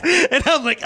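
ordinary delivery

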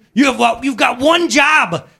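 high, loud, incredulous shout; mad. Acted. Homeowner yells at digital stove showing error, mock mad. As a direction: yell at the useless appliance in acted outrage. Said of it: "and so I'm mad".

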